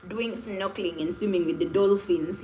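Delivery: usual speaking voice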